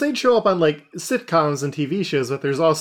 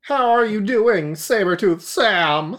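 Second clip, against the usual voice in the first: high pious preacher voice; a high, kindly priest greeting; Armadillon; 8 semitones higher, 4 dB louder than usual. Acted. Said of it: "It's Father Armadillo Don now".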